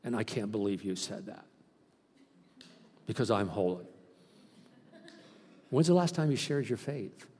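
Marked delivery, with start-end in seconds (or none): 0.0-3.8 lower, softer self-satisfied mock voice. Mocking older Christians who think they've arrived, smug. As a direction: play the complacent saint, pleased with himself. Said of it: "they go".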